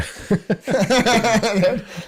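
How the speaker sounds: high, loud drawn-out laugh; long hearty laugh. Real, not acted. Naming his book's story, he breaks into a long loud laugh. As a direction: let the laugh roll on.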